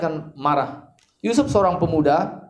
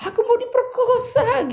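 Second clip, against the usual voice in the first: hammed-up falsetto scream of a fake victim, much higher; mock cry for help; slower than usual. Potiphar's wife falsely crying out to frame Yusuf, hammed-up high scream. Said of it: "Dia teriak".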